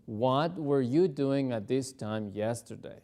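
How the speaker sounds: slow and clear; slow dialogue practice; slow practice question. Shown as a demonstration. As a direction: ask it slowly. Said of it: "Let's practice the conversation".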